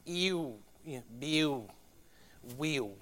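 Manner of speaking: Southern "ill" turned into a gliding "ew"; Southern accent. Shown as a demonstration. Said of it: "when you have that I L L, it becomes".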